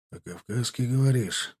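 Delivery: grind the words out coldly; through gritted teeth. A narrator's reading. Sergeant coldly questioning the soldier's offer, through teeth. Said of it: "процедил Тимофей".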